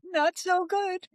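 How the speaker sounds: high and slow; small scared voice. Retold or imitated. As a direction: say it meekly, near tears. Scared reply to officer.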